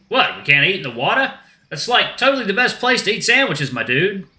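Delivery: high-pitched dude voice; protesting shark dude. Acted. Shark wants lunch in the water, high dude voice. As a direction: object like an easygoing surfer.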